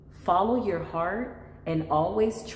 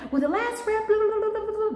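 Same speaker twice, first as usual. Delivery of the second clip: mocking customer; 3 dB louder than usual. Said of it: "they're like".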